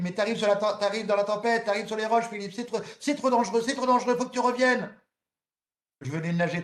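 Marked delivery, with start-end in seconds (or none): 0.0-4.9 alarmed coach. Retold or imitated. Coach warns the swimmer off the rocks, high and alarmed. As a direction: warn him urgently of danger. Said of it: "elle me dit".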